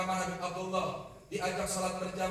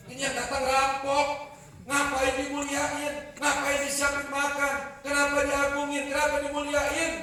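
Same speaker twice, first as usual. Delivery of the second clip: outraged preaching; 7 semitones higher, 6 dB louder than usual. Acted. Preacher voices outraged rhetorical questions.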